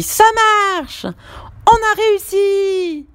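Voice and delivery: high, slow, drawn-out victory shout; triumphant cry. Acted. Julie celebrating their batter, high and stretched. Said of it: "s'écrie Julie".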